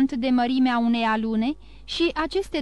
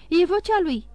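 excited recognition; 6 semitones higher, 4 dB louder than usual. A narrator's reading. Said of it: "spuse Julie".

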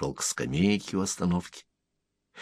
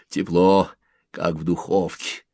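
ordinary delivery, nothing marked

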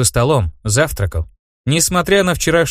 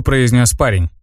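ordinary delivery

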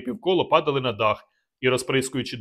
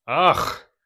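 loud, higher angry exclamation; gritted-teeth snarl; 4 semitones higher, 4 dB louder than usual. Acted. Rygor reacting to the escape, snarling through teeth. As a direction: spit it through clenched teeth. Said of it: "проскрипів зубами Ригор".

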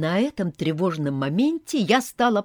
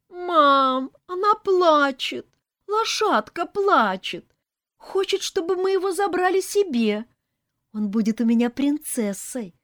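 acted child whimper; 12 semitones higher, 5 dB louder than usual. A narrator's reading. Little girl begging to take the horse, mock whimpering. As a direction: plead to mom like a tearful little girl. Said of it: "всхлипывала маленькая Марина".

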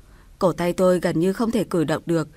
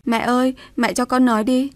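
higher, imploring voice, understated weeping; tearful plea; 6 semitones higher than usual. A narrator's reading. Widow on the ground begs mother-in-law, tearful plea. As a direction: beg tearfully to speak. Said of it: "khóc lóc nói với bà".

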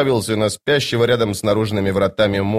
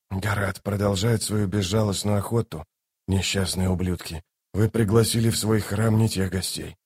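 softer, slightly low male figure; lightly voiced weary Toc; 3 semitones lower, 6 dB quieter than usual. Toc commenting on the slaughter in the temple, subdued. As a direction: keep it soft and subdued.

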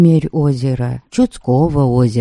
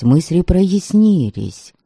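usual speaking voice

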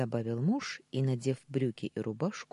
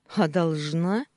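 loud, drawn-out challenge; snappish retort; 3 semitones higher, 7 dB louder, slower than usual. A narrator's reading. Wife snaps back at the maid, testy. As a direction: throw the question back testily. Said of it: "огрызнулась я".

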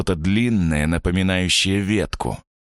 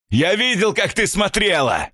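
furious shout. Acted. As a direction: bellow threats at the door. Stranger screams through the door.

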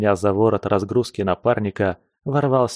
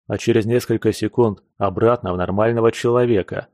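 plain reading voice

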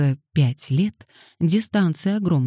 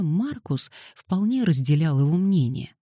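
ordinary talk, nothing marked

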